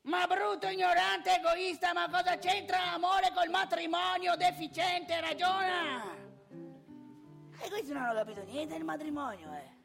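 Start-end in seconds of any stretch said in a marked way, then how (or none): 0.0-6.1 high, loud tirade of insults; sfuriata paterna. Acted. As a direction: scold a foolish son at full voice. Sfuriata recitata contro il figlio. (rimprovero furioso, gridato e acuto)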